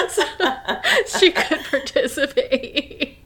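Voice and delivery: slow, high, giggling delivery; laughing. Real, not acted. Laughing at own retold story.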